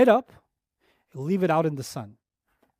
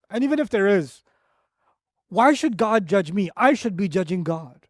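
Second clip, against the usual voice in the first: ordinary talk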